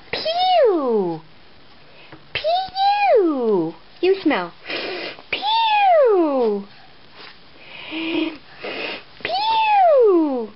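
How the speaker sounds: high mock-disgusted pew; playful disgust. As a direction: squeal at the stinky feet. Smelling baby's feet.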